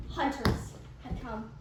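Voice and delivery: plain reading voice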